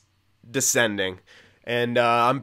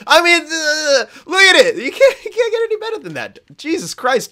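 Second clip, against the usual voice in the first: astonished gushing; 7 dB louder than usual. Real, not acted. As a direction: stretch it up high like you can't believe your eyes. Gushing over the goalie stats.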